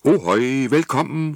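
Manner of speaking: high, loud, slow sailor's greeting; hearty hail. Acted. Old skipper elf greeting the children, loud slow hail. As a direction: boom a welcome across the quay. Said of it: "råbte nissen".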